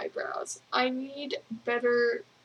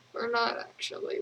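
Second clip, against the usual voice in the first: ordinary talk, nothing marked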